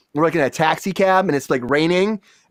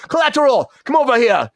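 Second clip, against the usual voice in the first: higher, mock call across a set; imitated director's yell; 7 semitones higher than usual. Director summoning a crew member, imitated yell. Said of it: "he'd yell it at me".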